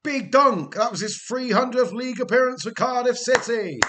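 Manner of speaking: loud, high celebratory call; rousing tribute. Pretend. Streamer hails his captain's three hundredth appearance, rousing and loud. Hailing club captain's milestone in game.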